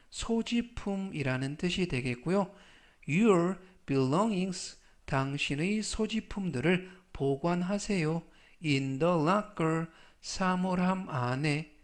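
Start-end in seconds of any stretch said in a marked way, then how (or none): 3.1-4.8 Your belongings said very slowly; slow model reading. Shown as a demonstration. Teacher reading the phrase to students, very slow. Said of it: "slow model reading of phrase". 8.6-9.9 Your belongings said very slowly; slow model reading. Shown as a demonstration. Teacher reading the phrase to students, very slow. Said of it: "slow model reading of phrase".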